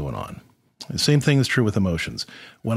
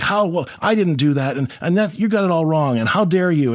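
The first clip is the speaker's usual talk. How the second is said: mock indignant, higher pitched protest; defensive retort; 7 semitones higher than usual. Husband snaps back defensively at his upset wife, mock indignant. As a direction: bristle back as if accused.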